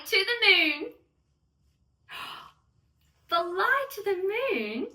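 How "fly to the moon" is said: gasping surprise. Acted. Narrator gasps at Lola's plan.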